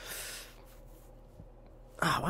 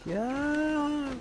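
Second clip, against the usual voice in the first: high engine whine, long and drawn out; toy car noise; 12 semitones higher, 5 dB quieter than usual. Pretend. Playing at Hot Wheels, imitated engine whine. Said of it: "I would make the noises".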